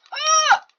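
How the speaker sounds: high, loud scream of alarm; startled scream. Real, not acted. Gamer screaming as shooting starts. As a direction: shriek at the sudden threat. Sudden danger in game.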